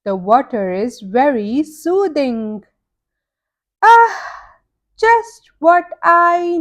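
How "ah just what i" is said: relieved sigh. Acted. Pete relaxing in hot lagoon.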